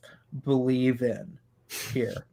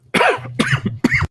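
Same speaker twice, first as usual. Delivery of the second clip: harsh choking cough; 7 dB louder than usual. Real, not acted. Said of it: "as he chokes".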